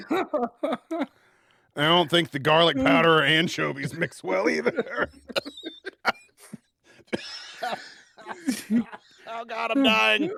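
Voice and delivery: laughing. Real, not acted. Laughing through the aftertaste.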